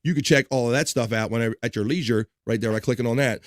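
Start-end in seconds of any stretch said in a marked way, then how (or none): none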